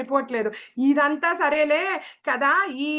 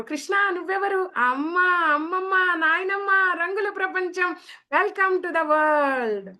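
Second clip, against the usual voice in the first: higher, slow, drawn-out sing-song greeting; welcoming the newborn; 6 semitones higher, slower than usual. Acted. World greets newborn baby. (పుట్టిన బిడ్డకు స్వాగతం పలికే పొడవైన పాట లాంటి గొంతు)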